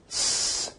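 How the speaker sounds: "S" as a long hiss with no voicing; voiceless s. Shown as a demonstration. Teacher producing two sounds in succession, "S" as a drawn-out voiceless hiss. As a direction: hold "S" as pure air, vocal cords still. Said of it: "so it's a voiceless sound".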